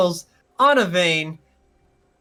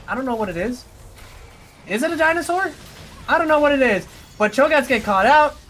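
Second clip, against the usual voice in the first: raised, energetic shoutcast voice; excited play-by-play; 6 semitones higher, 3 dB louder than usual. Real, not acted. Caster calling a chase and kills, excited play-by-play. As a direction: keep the kills rolling in a lively call. Live play-by-play of team fight.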